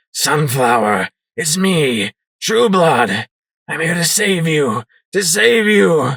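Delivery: a touch of desperate pleading, rising; desperate plea. Acted. Sanguine begging his terrified wife to calm, desperate. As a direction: plead with her to trust you. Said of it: "the ghoul cried desperately".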